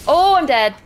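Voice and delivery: loud, high outburst; dismayed shout. Real, not acted. Gamer dying in the game, loud dismayed shout. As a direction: blurt it as you die.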